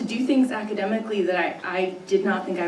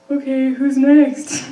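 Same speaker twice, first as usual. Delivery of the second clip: slow, louder, nervous laugh through it; awkward choke; 4 semitones higher, 8 dB louder, slower than usual. Retold or imitated. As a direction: brush past the moment with a nervous laugh. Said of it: "I completely choke".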